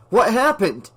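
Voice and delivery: high, loud alarmed question; sudden worried shout. A narrator's reading. Sudden stop, alarmed driver.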